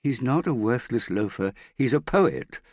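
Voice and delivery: indignant daughter snapping back; angry. A narrator's reading. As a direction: defend him hotly, peaking at the end. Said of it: "Hester said angrily".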